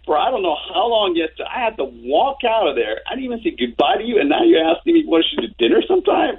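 high, disbelieving retort; incredulous. Retold or imitated. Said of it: "I'm looking at him like".

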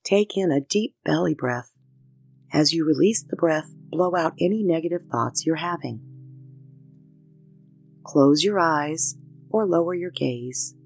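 calm unhurried meditation voice with pauses; guided meditation. Acted. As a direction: guide them gently through each breath. Leads a breathing meditation.

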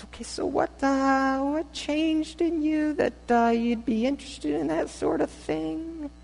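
a startled friend fumbling for words; stunned Becky. Acted.

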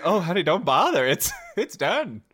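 loud, high mock brush-off; dismissive retold reply. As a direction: exaggerate a loud "don't bother, honey". Voicing others' dismissive reply, exasperated.

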